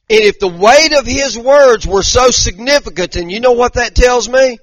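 loud, high, emphatic preaching; preaching at full force. Real, not acted. Preacher pressing the weight of Jesus' words on congregation, full-force preaching.

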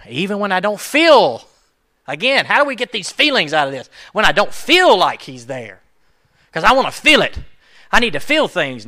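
loud, high, pleading cry to feel God; desperate plea. Acted. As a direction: beg to feel him near. Acting out longing to feel God.

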